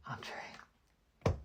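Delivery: breathy whisper of the name. Real, not acted. A vlogger whispering the next contestant's name. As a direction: whisper it like a secret.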